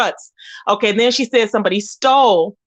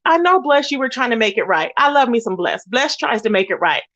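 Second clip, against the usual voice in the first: ordinary talk, nothing marked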